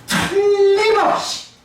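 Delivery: 틀림없이 slow and high; emphatic. Shown as a demonstration. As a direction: draw out 틀림없이 firmly. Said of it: "이 말은 무슨 말이냐면".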